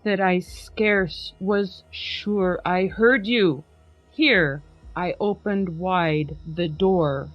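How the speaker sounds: plain reading voice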